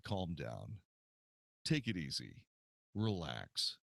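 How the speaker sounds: low, soft, soothing reassurance; calming. Retold or imitated. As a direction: talk a worked-up friend down, low and soft. Calming down his agitated friend.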